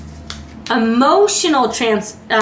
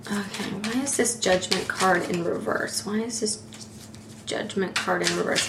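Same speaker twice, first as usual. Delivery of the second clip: muttering to herself; 3 semitones lower, 11 dB quieter than usual. Real, not acted. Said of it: "asking herself aloud".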